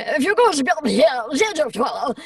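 high, loud impression with swooping pitch; Maggie Smith. Shown as a demonstration. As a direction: swoop through it like a grand character actress. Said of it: "Maggie Smith".

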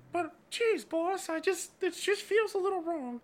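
very high, squeaky underling voice; meek sidekick doubting the boss, way up high; cartoonish high-pitched assistant; timid assistant. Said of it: "the assistant's just like".

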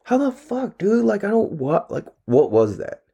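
high-pitched, stammering disbelief; indignant confusion at broken gear; baffled outburst. Retold or imitated. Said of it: "And I'm like".

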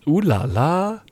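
slow, playful French accent. Shown as a demonstration. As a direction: put on a fancy French accent. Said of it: "I'll do a French accent".

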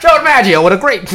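high, loud vintage sports broadcaster; old-timey radio announcer. Retold or imitated. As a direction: call it like a 1930s radio man. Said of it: "like one of those old-timey radio announcers".

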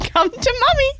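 put-on high, cooing baby talk; baby talk to dog. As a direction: coo to a cute dog. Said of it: "Kate goes to the dog".

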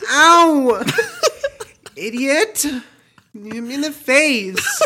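high, loud laughing yelp, slow at the end; rendition of young self. Retold or imitated. Redoing his childhood yelp for the podcast, high laughing shriek. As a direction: shriek like a hurt kid while cracking up. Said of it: "I'm just gonna go off of my rendition now".

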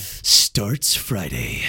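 deep, slow trailer voiceover; movie trailer voice. Pretend. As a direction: announce it like an epic film trailer. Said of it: "trailer voice you did right there".

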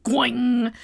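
loud, ringing gong noise; imitated gong. Teller imitates Magenta's big gong, loud ringing sound. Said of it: "big-ass gong, and she's like".

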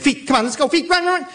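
put-on a scared kid bolting for home; panicked urging. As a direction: tell your feet to run, terrified. Boy fleeing in terror.

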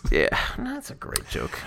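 breathy sarcastic whisper. Pretend. Sarcastic aside about old joke.

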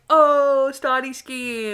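slow, drawn-out knowing aha; mock-smug aha; knowing realization. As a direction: savor the discovery slowly. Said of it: "And I was like".

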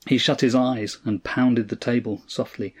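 ordinary delivery

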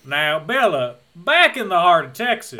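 hammed-up Texas accent; Southern drawl. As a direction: imitate a thick Southern drawl. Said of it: "his accent gets more and more southern".

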